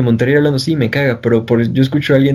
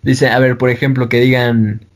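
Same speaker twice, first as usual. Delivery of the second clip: normal talking voice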